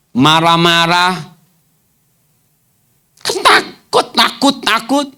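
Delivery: high mocking voice chiding the crybabies; mocking scold. Preacher mocking fearful disciples, high and scornful. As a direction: sneer at them for whimpering and being scared.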